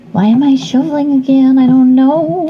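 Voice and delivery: high, cutesy cartoon girl voice; Harley Quinn voice. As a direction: stay in the squeaky Harley voice while chatting. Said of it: "he's gonna do my Harley Quinn".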